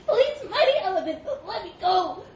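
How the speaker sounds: high, loud desperate squeal; gasping monkey plea. A narrator's reading. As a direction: beg for release while being squeezed. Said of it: "gasped the monkey".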